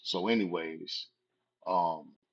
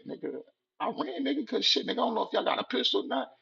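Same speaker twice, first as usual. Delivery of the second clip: fast, much higher frantic excuse; frantic explanation; faster than usual. Retold or imitated. Teen explaining to gang members, fast high frantic excuse. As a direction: blurt it out defensively.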